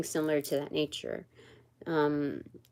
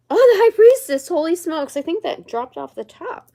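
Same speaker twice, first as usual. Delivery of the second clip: real surprise, high and loud, then settling; high-pitched, loud gasp of discovery easing into talk; startled exclamation; 9 semitones higher, 12 dB louder than usual. Real, not acted. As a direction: burst out, startled by a sudden drop. Card suddenly drops from the deck.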